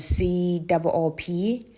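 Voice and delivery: C O O P said slowly, letter by letter; spelled out. Shown as a demonstration. Vlogger clarifying which supermarket, spelling it out. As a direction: spell out C O O P, each letter separate. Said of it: "spelled as".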